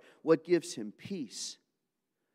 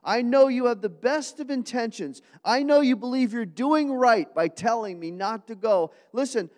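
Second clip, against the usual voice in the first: much higher, louder, slowed, pleading with weeping friends; pained Paul; 10 semitones higher, 9 dB louder than usual. Acted. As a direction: beg them to stop crying, voice aching. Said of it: "He even says".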